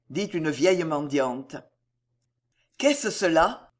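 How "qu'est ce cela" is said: ordinary delivery, nothing marked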